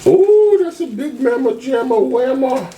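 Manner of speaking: high delighted squeal; excited squeal. Real, not acted. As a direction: squeal at the big food. Excited over big food.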